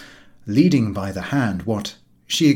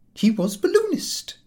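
lady's admission. Acted. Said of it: "admitted the lady".

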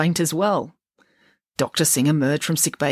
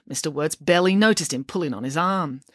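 ordinary delivery, nothing marked

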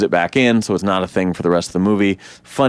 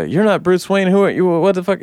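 confused Barry; 8 semitones higher than usual. Retold or imitated. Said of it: "Sort of like".

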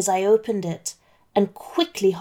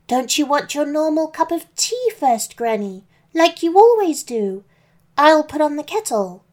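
put-on higher, louder sweet girl voice; little girl voice; 6 semitones higher, 3 dB louder than usual. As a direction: ask it like an innocent little girl.

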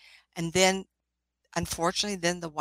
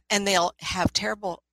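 ordinary talk, nothing marked

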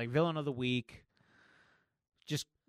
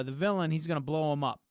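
ordinary talk

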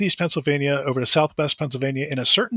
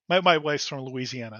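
ordinary talk, nothing marked

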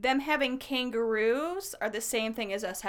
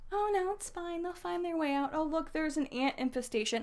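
lightly mocking nonchalance, pitched up; unbothered locals; 6 semitones higher than usual. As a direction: imitate easygoing locals shrugging it off. Voicing locals unbothered by pests.

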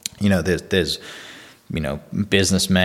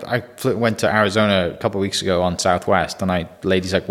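ordinary talk, nothing marked

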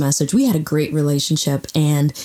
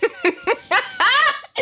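high laugh. Real, not acted. Laughing at own remark.

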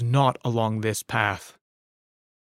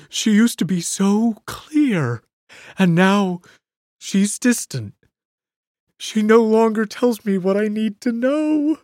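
distraught Bert; 6 semitones higher, 3 dB louder than usual. Acted. Bert raving about lost woman.

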